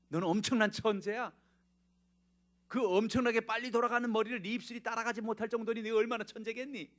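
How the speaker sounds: high, loud eager praise; encouraging mother. Retold or imitated. Edison's mother tells her son he is a genius, eager and loud. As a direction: lift the boy up with delight.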